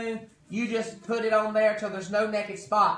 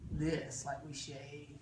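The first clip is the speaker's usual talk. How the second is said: quiet; 7 semitones lower, 13 dB quieter, slower than usual. Shown as a demonstration. Quiet demo.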